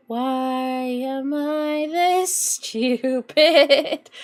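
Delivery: stretched very slowly, each word dragged out; milk the self-pity theatrically; hammed-up drawn-out wail. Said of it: "I'm gonna cry".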